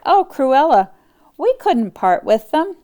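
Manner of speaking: high, pleading plea. Acted. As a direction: beg her to reconsider. Anita pleading with Cruella.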